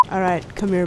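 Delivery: usual speaking voice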